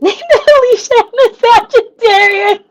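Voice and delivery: high, loud laughing squeal; words bursting out through real laughter; laughing hard. Real, not acted. Streamer finding out someone shares her name and sign, laughing hard. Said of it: "That's why I'm laughing so hard".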